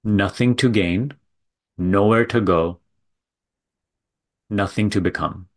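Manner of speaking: measured guiding delivery with long silences between phrases; contemplative spiritual teaching. Real, not acted. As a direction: let each phrase land, then leave a long pause. Leads a contemplative talk.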